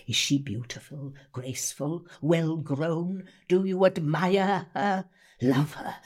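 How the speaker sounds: slow, intense reading with long pauses; Miss Havisham. Acted. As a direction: read it as a bitter, obsessed jilted bride. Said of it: "Miss Havisham is Dickens".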